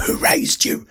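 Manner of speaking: whispered, unvoiced; mock whisper. Ranting reactor whispers at the girl in the video. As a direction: breathe it as a scolding whisper.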